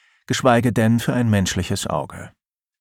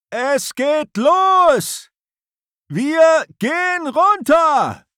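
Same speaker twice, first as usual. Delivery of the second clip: high, slow shout against a storm; shouting over wind; 5 dB louder, much slower than usual. Acted. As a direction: bellow it into the gale. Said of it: "versucht Erik gegen den Sturm anzubrüllen".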